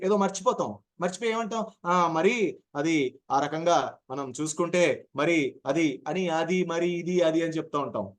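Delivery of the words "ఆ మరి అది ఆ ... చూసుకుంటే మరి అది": slow, groping with fillers; memory pause fumbling. Shown as a demonstration. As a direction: hesitate as if the point has slipped away. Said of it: "ఇది memory pause". (మర్చిపోయి తడబడుతూ నెమ్మదిగా)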